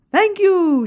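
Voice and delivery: high, loud and gleeful; delighted. A narrator's reading. Shelley picks up a tampon from the floor, delighted. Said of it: "Shelley is delighted".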